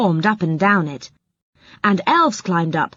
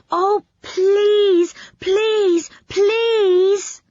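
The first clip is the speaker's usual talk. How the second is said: high, drawn-out tearful pleas; mock-sobbing please, much higher; whiny begging; 13 semitones higher, much slower than usual. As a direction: wheedle like a child who must go too. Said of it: "he begged".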